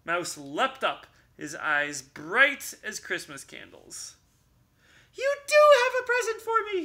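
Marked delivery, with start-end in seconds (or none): 5.1-6.8 fast, loud, high squealing cry; excited cry. Acted. Mouse crying out to Bear, fast and high. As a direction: blurt it out thrilled. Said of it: "he cried".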